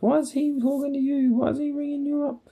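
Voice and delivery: high, incredulous mock complaint; mock indignant whining. Project manager griping about the call, mock high whine. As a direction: squeak it out like an outraged busybody.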